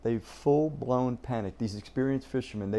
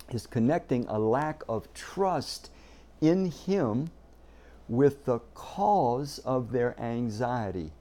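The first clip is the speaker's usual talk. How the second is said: ordinary delivery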